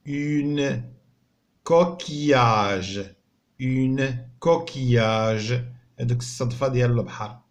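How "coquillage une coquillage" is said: coquillage said very slowly, syllable by syllable; teacher modelling French; slow model word. Shown as a demonstration. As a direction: stretch coquillage out for counting. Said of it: "شحال فيها من مقطع صوتي".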